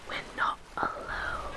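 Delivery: hushed unvoiced whisper, very soft. Warning her partner about a seagull in a hushed whisper. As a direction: whisper the warning as if being watched.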